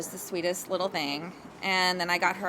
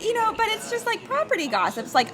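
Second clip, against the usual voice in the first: ordinary delivery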